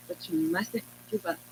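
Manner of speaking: a light, quiet imitation of the grande dame; Phylicia Rashad way. Guest imitating the actress urging her on, softly. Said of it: "and that Phylicia Rashad way".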